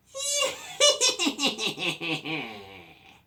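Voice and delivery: high, loud cackling witch laugh; creepy witch laugh. Pretend. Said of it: "I'm gonna do a creepy which laugh".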